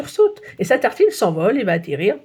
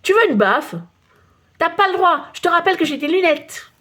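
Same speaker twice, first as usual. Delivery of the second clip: put-on higher child's voice, cheeky and indignant; squabbling schoolkids; 8 semitones higher, 3 dB louder than usual. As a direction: bicker like kids in the playground. Enfants qui se disputent. (voix aiguë d'enfants qui se chamaillent)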